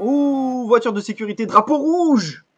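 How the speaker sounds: loud, high race-commentary outburst; excited exclamation. Real, not acted. Gamer calls a red flag to viewers, excited shout. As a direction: cry it out as sudden big news.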